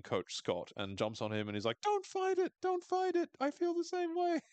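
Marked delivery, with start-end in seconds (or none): 1.8-4.4 very high, hammed-up pleading squeal; lovestruck girl. Misty jumping on Coach Scott, mock-passionate. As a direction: plead in a silly high swooning voice.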